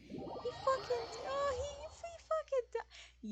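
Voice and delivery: shocked high-pitched. Real, not acted. Streamer shocked at death.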